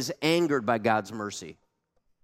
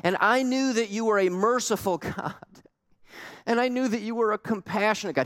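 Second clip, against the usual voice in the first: much higher, exasperated accusation; Jonah complaining to God; 7 semitones higher than usual. Retold or imitated. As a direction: throw it back at God in frustration. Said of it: "He's talking to God now".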